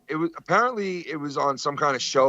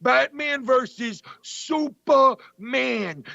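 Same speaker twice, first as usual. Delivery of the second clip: high, slow, drawn-out mock rant; imitating Dice; 11 semitones higher, slower than usual. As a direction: stretch it out like a comic mocking the title. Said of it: "he's like".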